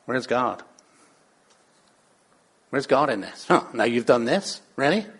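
low, soft needling doubt; nagging voice. Acted. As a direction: nag quietly in someone's ear. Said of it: "you've got a nagging".

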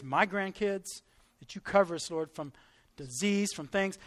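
subdued, measured prayer voice; congregational prayer. Real, not acted. Pastor asking God to shield the congregation, in subdued prayer.

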